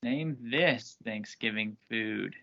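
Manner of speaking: slow, deliberate quiz question; game show host. Acted.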